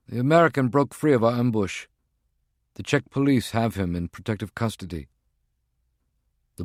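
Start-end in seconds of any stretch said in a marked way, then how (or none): 0.1-5.1 higher, brisk henchman's voice; a tense field agent reporting in; agent's voice. Acted. Voices Prague One on phone.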